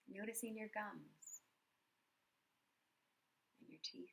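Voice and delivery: soft, unhurried meditation voice with long pauses; guided meditation. Acted. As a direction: guide them gently through a body scan, quietly. Leads a body scan meditation.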